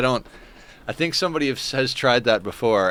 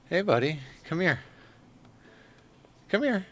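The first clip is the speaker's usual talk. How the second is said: higher, softer pet talk; cooing at the cat; 8 semitones higher, 3 dB quieter than usual. Real, not acted. Calling the cat over.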